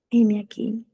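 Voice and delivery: subdued, half-hearted answer; mock reluctant. Believer answering God's warning of suffering, mock reluctant. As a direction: play a hesitant believer giving in. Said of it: "te voy a poner a sufrir".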